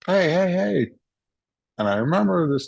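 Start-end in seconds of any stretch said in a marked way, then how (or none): 0.0-0.9 higher-pitched re-enacted call. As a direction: call to get someone's attention. Calling out to a passing driver.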